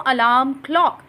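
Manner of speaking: ordinary delivery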